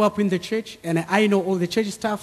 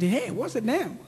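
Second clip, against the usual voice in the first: quoting her through a laugh, hushed; amused, soft laughing question; 3 semitones higher, 7 dB quieter than usual. Retold or imitated. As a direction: ask it while laughing in disbelief. Wife's amused reaction to baby name.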